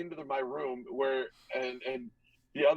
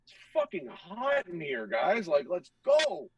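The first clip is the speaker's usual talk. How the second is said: drawn-out, higher-pitched griping holler; impatient call-out; 4 semitones higher, slower than usual. Retold or imitated. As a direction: urge the team on, stretching the words. Complains to teammates at the bench.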